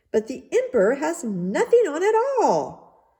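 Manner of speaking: put-on a child blurts the truth; little child. A narrator's reading. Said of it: "said a little child".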